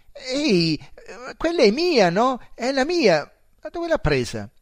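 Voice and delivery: high, loud startled cry; shocked outcry. Acted. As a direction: yelp in disbelief at the missing belt. Narrator sees his stolen belt.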